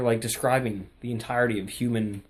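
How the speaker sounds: ordinary delivery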